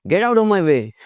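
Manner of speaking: rude brush-off. Shown as a demonstration. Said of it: "ऐसे नहीं कहना".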